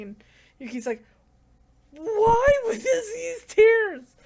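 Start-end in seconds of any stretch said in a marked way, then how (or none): 1.9-4.2 high, loud, drawn-out fake wail; mock crying. Yuki starts crying over her tears, hammed-up wail. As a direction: wail it slowly in a put-on sob. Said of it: "then she starts crying".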